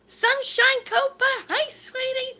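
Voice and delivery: high, delighted call of a name; light up at a friend's arrival; excited greeting. Real, not acted. Greeting a viewer in live chat.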